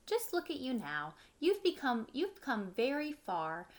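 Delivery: usual speaking voice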